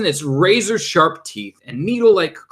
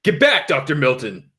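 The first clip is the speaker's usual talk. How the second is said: plain reading voice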